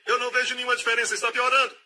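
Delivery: a worried believer frets to God, fast and high; anxious complaint. Acted. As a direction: panic that nothing is changing.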